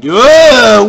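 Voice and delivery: loud sudden grunt of being hit; a sharp loud ugh as the hit lands; pained grunt. Real, not acted. Gamer hit by a kamikaze enemy, loud pained grunt.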